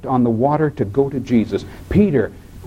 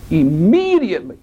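ordinary delivery

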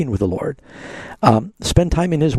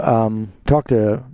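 usual speaking voice

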